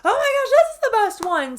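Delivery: hammed-up rising excitement; mock gushing. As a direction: gush even harder. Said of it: "I'm like".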